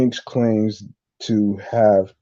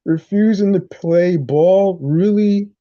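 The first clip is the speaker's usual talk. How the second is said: higher-pitched scoffing disbelief; incredulous; 9 semitones higher, 3 dB louder than usual. Real, not acted. Host reacting to the producer's claim, incredulous and higher. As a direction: throw it back as a disbelieving question.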